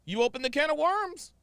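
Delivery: high-pitched, insistent goading; worked-up challenge. Real, not acted. Indignant challenge to the clip woman.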